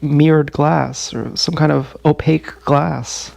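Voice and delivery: ordinary talk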